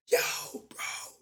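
urgent whispered plea, acted out; whispered call for help. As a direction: whisper it to a brother across the fight. Calling brother during retold fight.

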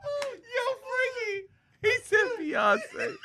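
high, wheezing laugh-talk; helpless laughter. Real, not acted. Host repeats co-host's shocking answer, cracking up. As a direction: squeak it out through real laughter.

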